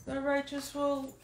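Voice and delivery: usual speaking voice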